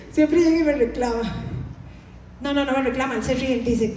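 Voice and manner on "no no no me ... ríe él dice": normal talking voice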